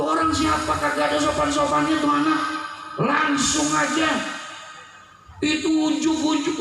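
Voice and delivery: high-pitched, indignant grumbling; acted annoyance. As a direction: complain as the crowd about the rude intruder. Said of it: "Semua orang marah, kesel".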